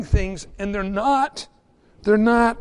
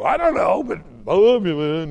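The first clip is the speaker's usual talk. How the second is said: goofy stranger; 2 semitones lower, 4 dB louder than usual. Acted. Acted stranger professing love.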